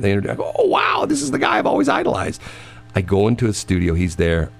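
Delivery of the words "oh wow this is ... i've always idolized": excited. Retold or imitated. Excited kid meeting his idol.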